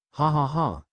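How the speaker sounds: a spelt-out ha ha ha in an altered voice; light acted laughter, not full-bodied; read-out laugh. A narrator's reading. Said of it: "trying to calm her laughter".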